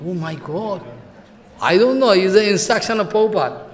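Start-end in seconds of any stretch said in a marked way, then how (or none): none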